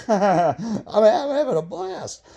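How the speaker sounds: high laughing delight. Real, not acted. Recalling the fun of fishing, laughing high. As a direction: laugh through the words, slow.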